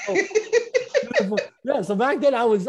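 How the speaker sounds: laughing through the words, voice jumping high; cracking up at his own punchline. Real, not acted. Laughing at his own bankruptcy story.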